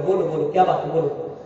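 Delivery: soft, low retold reassurance; hushed, patient coaxing; loving, gentle. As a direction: invite him tenderly to speak. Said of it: "प्यार से बोल रहे".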